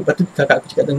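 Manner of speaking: ordinary delivery, nothing marked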